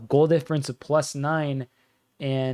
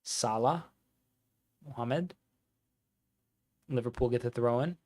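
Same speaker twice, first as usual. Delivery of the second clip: put-on Scouse accent; 5 dB quieter than usual. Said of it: "It's a good Scouse accent".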